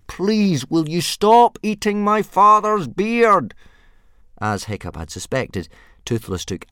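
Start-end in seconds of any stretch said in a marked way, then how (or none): 0.1-3.5 high, slow, pleading yell; yelling as loudly as possible. A narrator's reading. Boy yells at disobedient dragon, high polite holler. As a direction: strain to yell every word. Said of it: "Hiccup yelled as loudly as he could".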